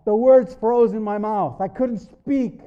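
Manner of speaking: high, loud dramatic cry; anguished outburst. Retold or imitated. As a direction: burst out at the climax of the testimony. Climax, reliving being struck speechless.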